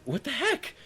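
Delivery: very high, incredulous question; retold disbelief. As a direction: act out disbelief, pitch shooting up. Ignored again at the door.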